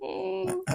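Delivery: high, soft echoed ding; soft, high bell noise; imitated bell ding. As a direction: echo a little bell chime.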